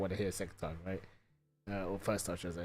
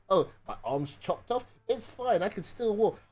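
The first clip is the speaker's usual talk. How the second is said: higher, louder hammed-up tough old dad; mock tough dad; 7 semitones higher, 6 dB louder than usual. Son mocking his stubborn dad who says he's fine, hammed-up. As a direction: brush off every injury like a stubborn dad. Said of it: "the old school dad that just goes".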